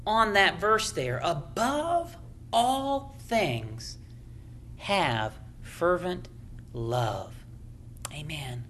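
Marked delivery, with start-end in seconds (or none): 1.2-7.4 softer, very slow, lingering on "Above"; soft, slow reading. Acted. Preacher softly repeating key verse. 8.1-8.6 breathy whisper of "Amen". Real, not acted. Whispered amen after verse.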